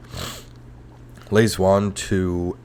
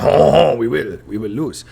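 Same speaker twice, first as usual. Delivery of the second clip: high, loud wailing "Oh" into defeat; hammed-up French defeatism; mock French; 6 semitones higher, 7 dB louder, slower than usual. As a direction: whine it like a hopeless Frenchman. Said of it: "And the French were like".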